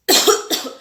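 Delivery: tos seca de demostración; tos fingida. Shown as a demonstration. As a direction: tose para que imiten. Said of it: "estamos tosiendo". (tos fingida)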